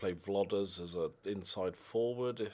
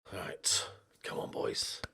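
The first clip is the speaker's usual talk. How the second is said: whisper; 3 semitones lower, 5 dB quieter, slower than usual. Real, not acted. Whispered encouragement to team.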